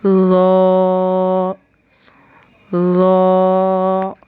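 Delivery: ज़ो drawn slow, tongue between the teeth; slow heavy ज़ो. Shown as a demonstration. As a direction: press the tongue and draw ज़ो out long. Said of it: "दो दांतों के बीच में दबाकर".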